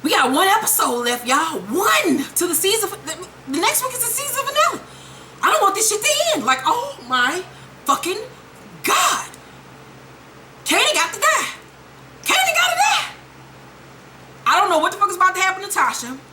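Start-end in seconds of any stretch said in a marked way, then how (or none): 3.0-9.3 high, fast gushing; can't contain the hype; high squealing rush of words; overexcited. Real, not acted. Excited about the finale. 10.6-11.5 high insistent Kanan got to die; high, eager Kanan got to die; excited demand. Real, not acted. Repeats it, then shouts. 12.2-13.1 very high shouted Kanan got to die; screaming Kanan got to die; shouted demand. Real, not acted. Repeats it, then shouts.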